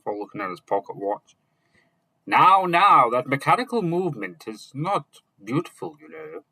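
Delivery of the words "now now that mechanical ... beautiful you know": high, loud then fading wizard; smiling exclamation. A narrator's reading. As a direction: start loud and high, let it drop away. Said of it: "Merlin exclaimed, smiling".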